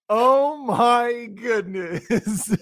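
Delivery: slow, drawn-out awe; amazed. Pretend. Picturing himself before God in heaven, awestruck. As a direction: let the words hang in wonder.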